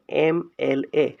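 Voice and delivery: एम एल ए said letter by letter; three separate letter names, एम, एल, ए; spelled-out pronunciation. Shown as a demonstration. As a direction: sound out each letter of एम एल ए. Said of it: "इसको हम बोल रहे हैं".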